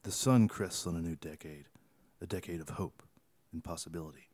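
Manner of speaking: low, soft, grand ad narration voice; mock-dramatic ad narrator. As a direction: read it like a moody commercial. Reading tweets in mock-epic tone.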